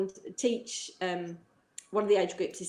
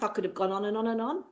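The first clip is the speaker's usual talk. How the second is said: ordinary delivery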